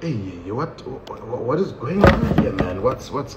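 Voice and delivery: soft, startled "what is going on here"; shocked protest. Retold or imitated. Comedian watching the harsh search, quiet shocked disbelief. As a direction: react in disbelief under your breath. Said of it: "I'm sitting there like".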